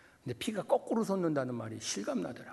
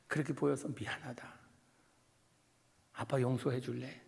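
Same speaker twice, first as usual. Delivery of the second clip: tender plea. Retold or imitated. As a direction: hold him close and ask gently. Father asking son's forgiveness.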